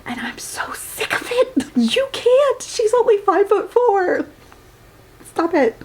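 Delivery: exasperated. Real, not acted. Exasperated rant at the book.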